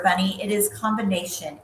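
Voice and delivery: ordinary delivery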